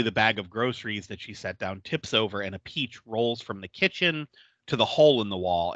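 ordinary delivery

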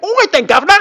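high, loud caricature Cockney; bad Cockney accent. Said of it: "people think, like".

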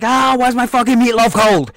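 loud, high mock bellow; angry husband. Cop yelling at wife over meatloaf, mock bellow. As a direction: roar about cold dinner. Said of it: "he's like".

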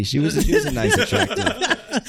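high giggly squeak; laughing. Real, not acted. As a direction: laugh through the words. Laughs describing the girl.

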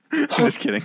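laughing through the words; chuckling apology for the joke. Real, not acted. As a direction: giggle it out as a tease. Laughing after teasing.

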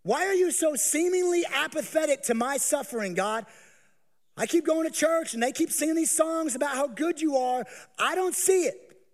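high, loud, bitter accusation; accusing God. Acted. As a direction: demand answers from God. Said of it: "You've accused God".

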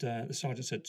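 ordinary delivery, nothing marked

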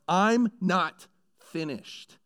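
a weighty "I'm not finished", slow and firm; emphatic declaration. Retold or imitated. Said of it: "it's this moment of".

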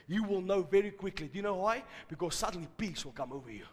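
hushed, intimate sermon voice; soft preaching. Acted. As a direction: preach softly and close. Quiet intimate sermon opening.

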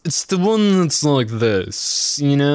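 drawn-out, pretentious Oxford accent; Oxford British accent. Shown as a demonstration. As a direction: put on an exaggerated posh Oxford accent. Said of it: "a specific British accent".

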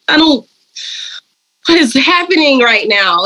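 retold bewilderment. Retold flustered reply on intercom.

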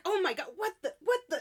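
high-pitched, aghast outburst; mock freak-out. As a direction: act out a flustered grandma, half laughing. Grandma reacts to screamed greeting.

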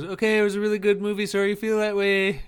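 high, fast, chirpy dismissal; breezy brush-off. Retold or imitated. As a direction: brush him off sweetly. Adi brushes off the ranter.

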